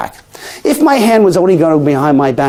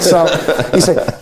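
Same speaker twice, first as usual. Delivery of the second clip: ordinary talk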